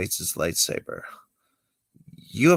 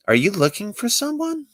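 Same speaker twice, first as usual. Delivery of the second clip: put-on much higher, girlish come-on voice; Twi'lek woman; 13 semitones higher than usual. Twi'lek greeting Kenobi in the cantina, high girlish voice.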